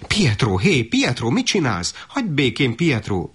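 high, sharp motherly telling-off; alarmed reprimand, not shouted; scolding. A narrator's reading. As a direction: scold the child to stop, pitch jumping up. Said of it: "pirított rá az anyjuk".

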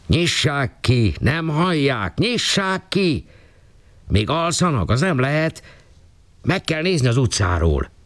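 high, urgent crowd voices knocking and shouting; alarmed neighbours. Acted. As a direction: call out like anxious neighbours at a locked flat. Neighbours calling at locked door.

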